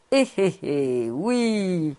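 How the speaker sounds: slow acted old rabbi's chuckle; sly chuckle. A narrator's reading. Old rabbi relishing the praise of Suzelle, chuckling. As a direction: draw out a knowing eh-eh-eh.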